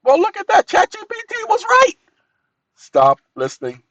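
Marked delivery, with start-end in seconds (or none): 0.0-2.0 much higher and louder, fast gloating; triumphant. Real, not acted. Man gloating that ChatGPT proved him right, excited. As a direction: crow over the win.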